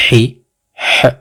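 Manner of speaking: usual speaking voice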